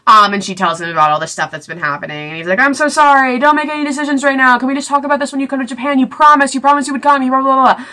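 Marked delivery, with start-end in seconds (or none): 2.6-7.9 fast, high imitation of frantic begging; hammed-up rapid begging dissolving into blahs; mock pleading. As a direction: rattle out the apology in a higher, hurried voice. Retelling Hayes's frantic pleading.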